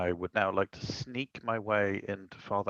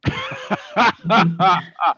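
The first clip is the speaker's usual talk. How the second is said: loud, high burst of laughter; real laugh; 7 semitones higher, 10 dB louder than usual. Real, not acted. Player relieved at a near miss, laughing loudly. Player reacts to barely failed check.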